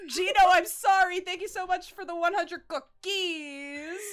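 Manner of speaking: loud, high gushing thanks; excited shout of thanks. Real, not acted. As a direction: blurt it out to the donor. Thanking a donor, loud outburst.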